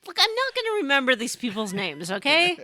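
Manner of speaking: mock exasperated. Real, not acted. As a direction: throw up your hands.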